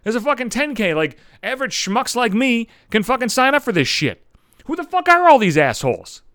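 indignant high-pitched rant, building to a loud finish; pissed off. Acted. Average runner protesting the ringers in a ten K, pissed off. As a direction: protest the unfairness, voice climbing. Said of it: "I'm starting to get pissed at this point".

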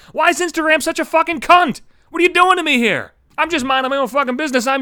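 high, loud outburst at Instagram; shouting at Instagram, pitch way up; angry rant. Real, not acted. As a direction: yell up at the app, fed up. Ranting at Instagram.

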